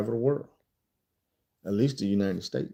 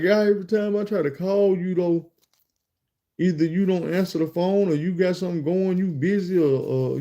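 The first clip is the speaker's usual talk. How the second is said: higher voice, lightly imitating his grievance; complaining drunk caller; 6 semitones higher than usual. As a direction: imitate a man pleading his complaint, pitched up. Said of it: "I guess dude was drunk".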